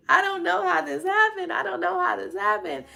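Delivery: high, laughing bewildered repeat; laughing disbelief. Retold or imitated. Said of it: "I kept on saying".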